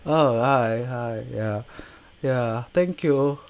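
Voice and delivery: soft, halting mumble; lightly voiced shy star; a hint of an awkward actor; awkward celebrity reply. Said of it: "It's just like".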